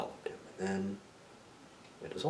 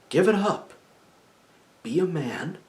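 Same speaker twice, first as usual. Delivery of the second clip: raised, emphatic man-to-man push; forceful urging; 9 semitones higher, 7 dB louder than usual. Retold or imitated. Telling a ghost to cross over, forceful urging. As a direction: urge him firmly, higher and louder.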